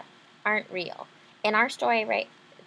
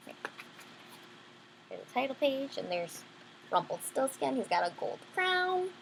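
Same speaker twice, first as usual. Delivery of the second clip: ordinary delivery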